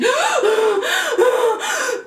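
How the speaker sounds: high heaving panic breath; hammed-up frantic gasp; panicked gasp. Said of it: "panic attack in this bitch".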